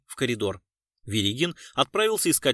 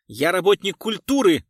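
loud, raised-pitch indignation; indignant; 5 semitones higher, 7 dB louder than usual. A narrator's reading. Culture worker answers the detective's question, indignant. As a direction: announce it with affronted outrage. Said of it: "негодующе ответил господин".

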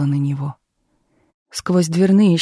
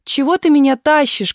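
high, louder hurt protest; offended child's cry; 8 semitones higher, 5 dB louder than usual. A narrator's reading. Girl dragged off by grandmother, crying out offended. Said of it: "кричала обиженная Галя".

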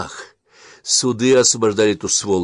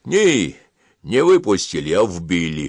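plain reading voice

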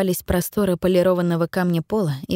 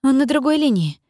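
over walkie talkie; 6 semitones higher than usual. Acted. Dispatcher answering over radio.

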